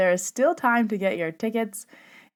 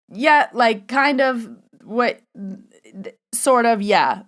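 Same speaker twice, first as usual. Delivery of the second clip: retold hedge, higher and louder; awkward hedging; 4 semitones higher, 6 dB louder, slower than usual. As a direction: hedge in halting bursts.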